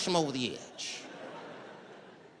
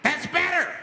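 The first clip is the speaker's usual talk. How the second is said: high, loud crazed approval; flipped-out man; 12 semitones higher, 5 dB louder than usual. Retold or imitated. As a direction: bark it high at the hostages. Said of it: "Now the guy flips out".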